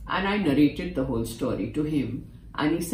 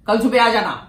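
higher and louder, breaking into laughter; laughing reply; 7 semitones higher, 6 dB louder, much faster than usual. Retold or imitated. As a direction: give in with a laugh. Guru relents laughing, retold.

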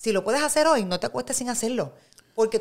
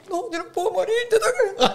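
mock panic; 12 semitones higher, 4 dB louder than usual. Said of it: "Y yo decía".

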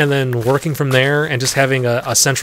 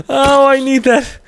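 dismayed whine; 10 semitones higher, 4 dB louder than usual. Real, not acted. Player wanting the lost item back, high dismayed whine. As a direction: whine it high over the loss. Loses needed item in game.

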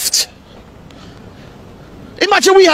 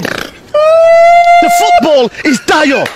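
exasperated; 3 dB louder, much slower than usual. Real, not acted. Fan rant boiling over.

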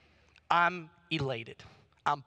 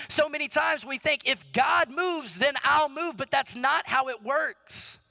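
raised, emphatic sermon voice; impassioned preaching; 7 semitones higher, 4 dB louder, slower than usual. Acted. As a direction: push hard, then stretch the last words slowly. Preaches principle at full force.

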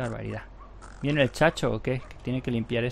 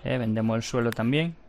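ordinary talk, nothing marked

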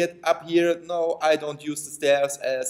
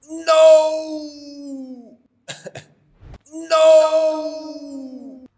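hammed-up loud high No; mock anguished cry; 9 semitones higher, 5 dB louder than usual. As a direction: wail it like a melodrama. Dramatic mock despair.

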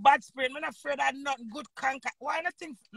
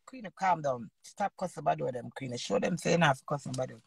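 a lower voice telling Queen to calm down, lightly acted; scolding voice; 8 semitones lower, 3 dB quieter than usual. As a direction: play a stern critic hushing her. Another voice scolding her cussing.

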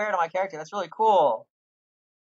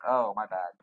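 usual speaking voice

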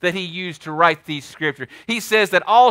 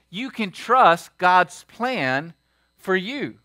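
very slow, every word spaced; slow reassurance; much slower than usual. Acted. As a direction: reassure the ladies slowly. Reassuring the ladies.

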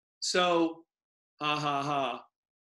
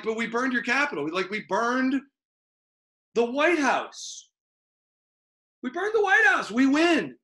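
indignant British; 6 semitones higher than usual. Acted. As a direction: insist like a proud redcoat who just burned the capital. British claim victory, mocked.

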